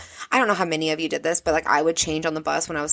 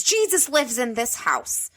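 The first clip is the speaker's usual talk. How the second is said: slow, higher mock outrage; imitated scream; 5 semitones higher, slower than usual. Stepmom ripping off teen's pentagram, mock-outraged scolding. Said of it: "as she screams".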